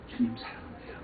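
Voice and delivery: soft whisper of love to God; whispered prayer. Retold or imitated. Pastor reliving waking by the sea, whispered prayer. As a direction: breathe it as a hushed confession.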